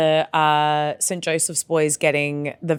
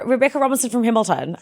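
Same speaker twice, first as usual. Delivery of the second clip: put-on higher-pitched broad Kiwi accent; New Zealand accent; 4 semitones higher than usual. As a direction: imitate a strong Kiwi speaker. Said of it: "New Zealand".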